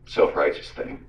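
ordinary delivery

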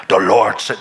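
low, clumsy mock Russian accent; bad Russian accent. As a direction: badly fake a Russian accent, pitched low. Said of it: "that's my bad Russian accent".

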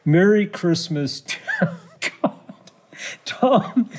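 trying not to laugh while reading; cracking up. Real, not acted. Host failing to read Trump's Christmas message aloud, cracking up. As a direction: struggle through it, breaking into laughter. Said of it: "it's hard to get through this".

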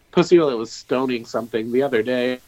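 ordinary talk, nothing marked

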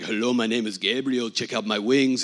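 low, put-on angel voice; jokey angel introducing himself in a deep voice; Gabriel. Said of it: "He's like".

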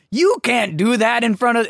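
high-pitched mock outrage; protesting Fudge. Acting out Fudge objecting to the port key, high protest.

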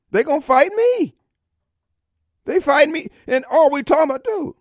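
indignant. Real, not acted. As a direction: protest it with rising heat. Indignant rise.

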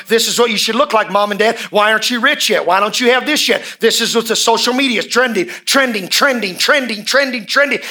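rising, pounding preacher cadence; preaching at full force. Acted. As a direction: drive the repeats harder each time.

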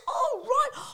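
high, loud acted squeal; high-pitched mock surprise; dawning realisation. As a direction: react as the penny drops. Acting out her sudden realisation.

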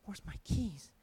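soft, low dismayed question; quiet dismay. Retold or imitated. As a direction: ask it under your breath in shock. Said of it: "And I said".

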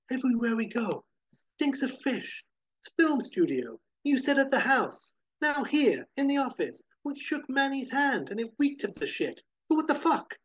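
high-pitched, insistent venting; exasperated argument. A narrator's reading. Joe argues back, exasperated.

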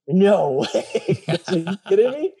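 high, laughing no way; disbelieving laugh. Real, not acted. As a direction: laugh out your disbelief at the news. Disbelief at job offer.

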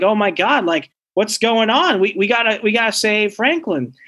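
high-pitched mock urgency; retold alarm. Parents running to save their son, retold in high alarm.

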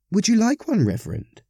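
high, lightly acted lady's voice; offering parishioner. A narrator's reading. Parishioner offering the Reverend a muffin, high and coaxing. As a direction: start high and coax, dropping on the title.

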